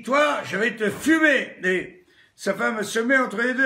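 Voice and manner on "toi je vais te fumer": shouted threat, louder and higher; angry husband shouting a death threat; furious threat. Acted. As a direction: bark it at him like you'll shoot. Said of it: "Le mec, il dit".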